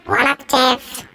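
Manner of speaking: high voice effect; octave up voice. Shown as a demonstration. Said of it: "we got various settings".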